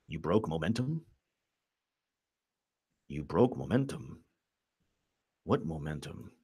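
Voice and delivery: very soft, low muttering; hushed mock dialogue. Host playing out who broke the momentum, hushed and low. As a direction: drop it low and quiet.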